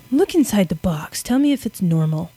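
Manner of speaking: lightly voiced stage magician; a touch of cheesy magic-show patter. Old scientist showing off his trick boxes, lightly hammed-up magician. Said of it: "like a little magic show".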